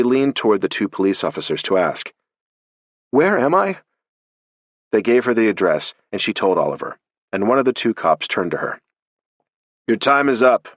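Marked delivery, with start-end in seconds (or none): 3.1-3.8 high, bewildered echo; flustered. A narrator's reading. Alex echoing Oliver's question, high and bewildered. 9.9-10.7 stern. A narrator's reading. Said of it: "he said sternly".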